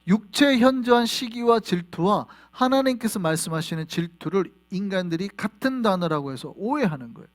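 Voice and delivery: normal talking voice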